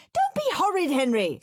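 high, loud scolding; screaming mum. A narrator's reading. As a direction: scold the boy at full voice. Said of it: "screamed Mum".

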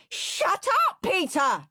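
high shouted shut up; scream. Acted. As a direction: scream at your brother. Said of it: "screamed Henry".

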